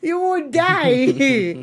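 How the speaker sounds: slow, heavy you would die; mock-grave slow warning; drawn-out warning. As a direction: stretch every word out.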